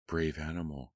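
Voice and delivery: usual speaking voice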